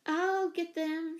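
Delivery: put-on high, drawn-out child's call; mild shout. A narrator's reading. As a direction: call out slowly, pitched high. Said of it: "shouted Jane".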